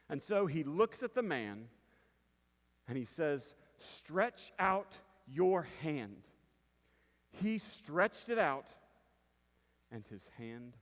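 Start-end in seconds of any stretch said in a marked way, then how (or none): 3.8-6.2 slow command. Acted. Jesus commands the withered man.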